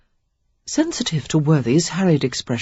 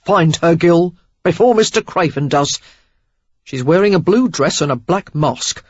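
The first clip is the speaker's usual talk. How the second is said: loud and pressing; urgent loud order; 5 dB louder than usual. A narrator's reading. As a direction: urge him to act now.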